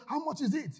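high, indignant demand; mock-indignant husband; upset man. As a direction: fire the question back, offended. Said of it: "the man was now upset".

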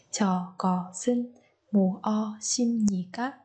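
"Cho có xin mua xin nhỉ các?" spoken very slowly, each word separate, sounds not linked; slow teacher demo, every syllable apart. Shown as a demonstration. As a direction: spell it out slowly for learners, no linking. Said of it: "Chúng ta nối âm ở đây và ở đây".

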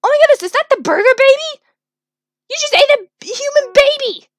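high, loud outraged disbelief; loud, high-pitched shocked accusation; horrified shock. Acted. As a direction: exclaim in hammed-up horror. Horrified the robot ate baby.

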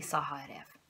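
ordinary delivery, nothing marked

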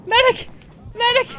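loud high rising calls for a medic; man down shout. Real, not acted. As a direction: call out urgently, rising. Calling for a medic in game.

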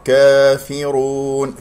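slow chanted "كافرون" with open long aa; correct recitation. Shown as a demonstration. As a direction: recite "كافرون" with clear kasra on the fa, held ending. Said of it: "في كسرة الفاء جيدا".

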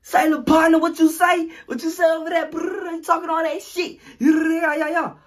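high, loud mocking taunt; taunting rival. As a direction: call out a rival cockily. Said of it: "all you heard is".

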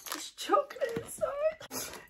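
very high, breath-catching squeak; gasp, then squeal it slowly; thrilled high-pitched discovery; gasping delight. Real, not acted. Delighted discovery while reading aloud.

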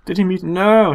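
ordinary talk, nothing marked

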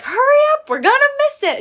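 high, loud excited urging; excited mom. Retold or imitated. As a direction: hurry them along, thrilled. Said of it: "She's like".

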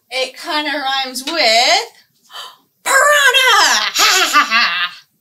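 loud cackling laugh after piranha; gleeful cackle. Acted. Librarian revealing piranha rhyme, loud gleeful cackle. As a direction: burst into a wicked laugh.

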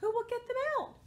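high-pitched plea for help; story question. Acted. Animals asking who will rescue cow and pig, high plea. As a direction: ask it high, falling at the end.

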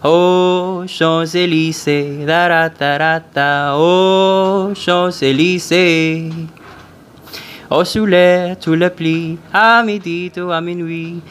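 mock French singing; switching accents clumsily. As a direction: sing in a hammy French accent. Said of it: "impossible for me to do French like".

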